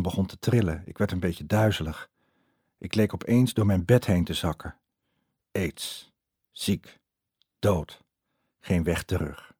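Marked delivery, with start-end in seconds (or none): 5.5-9.6 soft, halting words, one dropping to a whisper; each word a step into dread; frightened thoughts. Retold or imitated. Narrator panicking inwardly in bed, soft halting dread. Fearful thoughts after the news.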